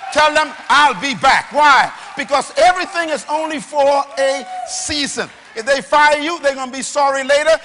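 loud, driving sermon delivery; rally the congregation with punched declarations; full-force preaching. Real, not acted.

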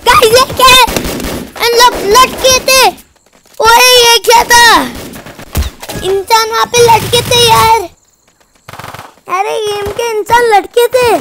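stunned by what he sees; shocked disbelief. Real, not acted. Telling viewers about hanging figures, high amazement.

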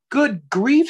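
high, bright-eyed surprise as the big parent bear; Large, surprised. Acted. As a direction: lift it up high like a startled parent. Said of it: "said Large".

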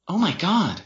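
high, shocked exclamation; gasped-out astonishment, pitch jumping; surprised. A narrator's reading. Sister hearing of the proposal, surprised. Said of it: "Jen covered her mouth in surprise".

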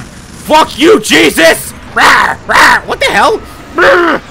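loud, high shouting; furious yell. Real, not acted. Gamer yelling at Jesus boss in fury. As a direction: scream at the enemy.

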